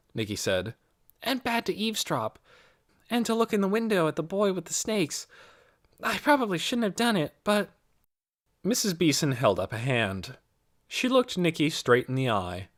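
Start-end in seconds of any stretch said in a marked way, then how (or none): 1.2-7.7 a hint of a girl's higher voice; lightly voiced young girl. A narrator's reading. Nicky answers Mrs. Beeson, lightly voiced as a girl. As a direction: lightly voice Nicky, eager and polite.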